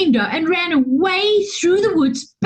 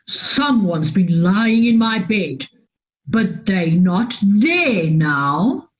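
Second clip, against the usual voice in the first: lower, growling bear voice; gruff Daddy Bear growl; 7 semitones lower than usual. A narrator's reading. Daddy Bear finds his bed used, low growl. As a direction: rumble it like a big bear. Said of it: "growled Daddy Bear".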